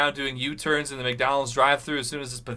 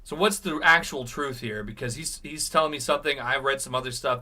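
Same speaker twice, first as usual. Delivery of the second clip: ordinary talk, nothing marked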